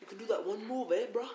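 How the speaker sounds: a light mock English accent. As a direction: gym mate chatting in a put-on English accent. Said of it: "I'm trying to do my English accent".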